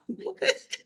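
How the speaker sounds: high squeaky laugh through the word; laughing disbelief. Real, not acted. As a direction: laugh out an incredulous what. Real disbelief at the memory.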